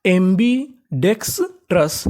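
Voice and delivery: एम्बीडेक्सट्रस drawn out slowly, syllable by syllable; slow blended word. Shown as a demonstration. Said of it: "अब जोड़ के पढ़ते हैं".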